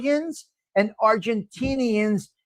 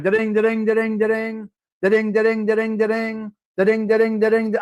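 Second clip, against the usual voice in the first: imitated phone ring. Imitating old kitchen phone ringing.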